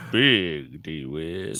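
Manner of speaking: slow, drawn-out DJ announcer; old radio voice. Shown as a demonstration. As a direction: boom it out like an old radio host. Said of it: "I missed your old radio voice".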